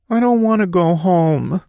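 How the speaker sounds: put-on high, wavering little boy's voice; a small boy near tears; quivering child voice. A narrator's reading. Boy begging his dad not to leave, quivering. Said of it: "his voice quivering".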